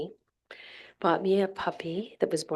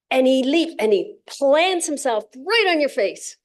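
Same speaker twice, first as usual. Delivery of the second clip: excited; 5 semitones higher, 6 dB louder than usual. Real, not acted. Excited puppy story.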